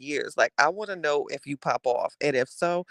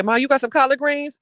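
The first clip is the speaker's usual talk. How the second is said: higher, louder casual mock [name]; imagined [name] at home; 10 semitones higher, 5 dB louder than usual. [name] walking in asking Mama Jackson, higher mock voice. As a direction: lightly voice [name] calling to mom. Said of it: "she say".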